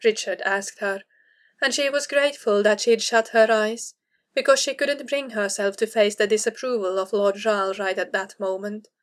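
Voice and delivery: ordinary delivery, nothing marked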